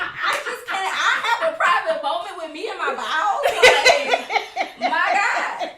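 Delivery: high, loud, laughing exasperation; laughing mock plea. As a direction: play up her own dramatic excuse. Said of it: "And I'm like".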